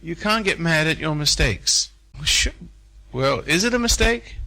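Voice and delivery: ordinary talk, nothing marked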